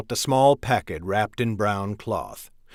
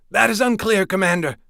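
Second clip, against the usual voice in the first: much higher, urgent messenger voice; 9 semitones higher, 3 dB louder, faster than usual. A narrator's reading. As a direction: call out the alarm in a raised voice. Messenger answers.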